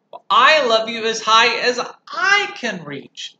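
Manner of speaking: loud and slow; big hare outdoing him. Acted. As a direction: answer gently but grandly. Big hare reaches higher.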